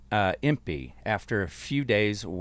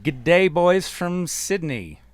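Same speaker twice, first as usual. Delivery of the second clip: high, slow, drawn-out g'day; hammed-up listener's greeting, pitched high; hearty read-out greeting; 7 semitones higher, 4 dB louder, slower than usual. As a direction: stretch it out like a big hello to the lads. Reads out Australian listener's greeting.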